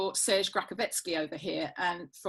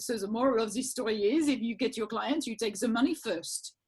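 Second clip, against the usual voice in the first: imitating a scientist's French accent. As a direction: put on a French accent for the punchline. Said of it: "he's in his French accent".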